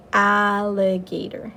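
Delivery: "Alligator." slow, with a loud, stretched opening A; stressed short A. Shown as a demonstration. Teacher modeling the short A sound for children, slow and stretched. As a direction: draw out the A in "Alligator." so kids hear it. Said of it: "if you hear the A sound at the beginning".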